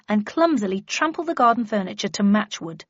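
usual speaking voice

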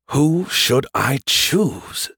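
very slow, drawn-out pondering; milk the suspense, word by word; dramatic muttering. A narrator's reading. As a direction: stretch every word for effect. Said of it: "muttered for dramatic effect".

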